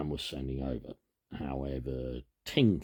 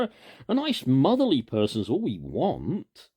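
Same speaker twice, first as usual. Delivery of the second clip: put-on high, loud boyish insistence; eager Peter; 9 semitones higher, 7 dB louder than usual. A narrator's reading. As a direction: brush the worry aside like an excited boy. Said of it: "said Peter".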